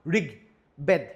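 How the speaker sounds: ঋগ and বেদ said apart with a pause between them; split at the stop. Shown as a demonstration. Teacher splitting the word ঋগবেদ in two for the class. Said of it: "আমি যেন থামি".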